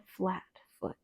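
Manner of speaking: ordinary delivery, nothing marked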